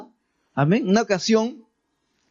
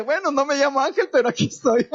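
a higher, laughing retold reply; 6 semitones higher than usual. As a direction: chuckle through the punchline. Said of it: "Le dije".

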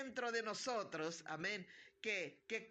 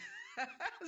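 light laugh. Real, not acted. Laughing at start of service.

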